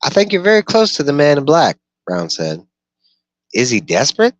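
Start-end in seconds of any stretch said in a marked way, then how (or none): 0.0-1.8 high, loud raised voice for the dweller; Brown's voice. Acted. Dweller Brown questioning the gunslinger by the fire, high and loud. 3.5-4.4 high, loud questioning voice; Brown's voice. Acted. Dweller Brown asking the gunslinger, high and loud.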